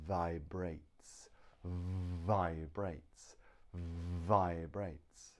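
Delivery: vibrates with a long "vvv" at the start; buzzed initial v. Shown as a demonstration. Teacher explains how V is made, vibrates with its first sound held. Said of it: "V is made by putting your teeth".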